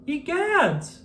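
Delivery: high, loud shocked exclamation; cried out. A narrator's reading. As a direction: yelp it in shock. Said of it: "cried George".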